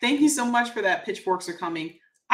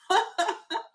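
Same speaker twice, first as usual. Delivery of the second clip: laugh; 8 semitones higher than usual. Real, not acted. Laughing at own read.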